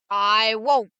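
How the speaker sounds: understated witch scream; mild mock scream. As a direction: witch refusing the soldier, mildly hammed. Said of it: "the witch screamed at him".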